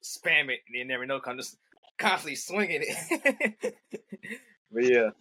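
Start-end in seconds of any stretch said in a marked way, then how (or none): 2.0-3.9 slow, higher, laughing through it. Real, not acted. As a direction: giggle the words out slowly. Laughing while describing spamming attacks.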